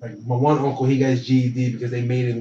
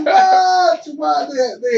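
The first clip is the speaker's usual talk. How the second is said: surprised shout; 7 dB louder, slower than usual. Real, not acted. Reacting to host's remark.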